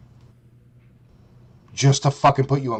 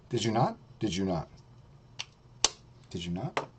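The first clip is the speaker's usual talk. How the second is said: softer and softer, lower each time; fading taunt; 6 semitones lower, 13 dB quieter than usual. Acted. Taunting the rival, fading lower and softer. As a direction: let each repeat sink lower.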